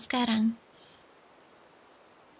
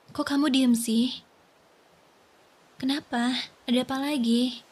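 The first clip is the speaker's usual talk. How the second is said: normal talking voice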